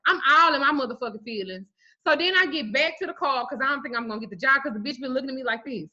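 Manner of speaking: ordinary talk, nothing marked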